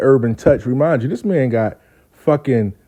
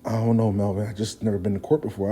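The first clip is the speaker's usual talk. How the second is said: low, soft worried reply; mildly stressed; 4 semitones lower, 8 dB quieter, faster than usual. Retold or imitated. Friend who never went to court, quietly uneasy. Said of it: "so why you stress about it?".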